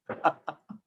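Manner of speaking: short soft chuckle; brief quiet laugh to himself. Real, not acted. Amused by his own tale.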